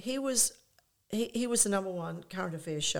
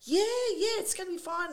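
high, bright-sounding reassurance, "yeah, yeah" pitched way up; mock reassuring; 12 semitones higher than usual. Manager calming nervous [name] in the car, high mock reassurance. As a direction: reassure him over-brightly. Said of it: "I'm reassuring you".

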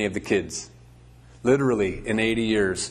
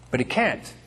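ordinary delivery

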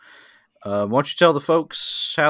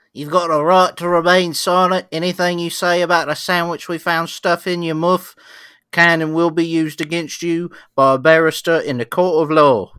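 loud, higher British cop reading rights; British police officer accent; 4 semitones higher, 4 dB louder than usual. Acted. Officer reading a suspect her rights, British accent. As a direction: put on a broad British accent. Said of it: "What does he sound like".

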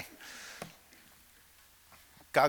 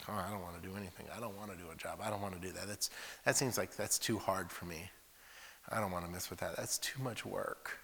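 much softer, droning lazy complaint; lazy whine; 3 semitones lower, 10 dB quieter than usual. Retold or imitated. Lazy people refusing any job, soft lazy whine. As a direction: whine like someone who hates work.

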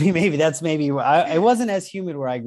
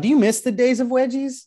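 high ad voice; 10 semitones higher, 3 dB louder than usual. Pretend. Mock ad pitch.

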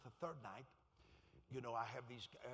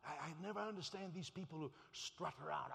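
ordinary talk, nothing marked

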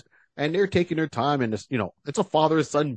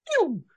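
high, light "pew"; toy-gun shot noise; imitated gunshot.

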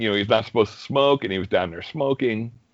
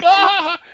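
cheer; 7 dB louder than usual. Retold or imitated. Crowd cheering.

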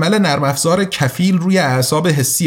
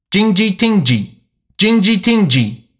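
higher-pitched imitation of Chinese speech; mock Chinese; 5 semitones higher than usual. A narrator's reading. Device's AI posting an emergency shutdown notice, in higher mock Chinese. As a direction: say it as a Chinese alert, pitched up and falling. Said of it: "به زبان چینی".

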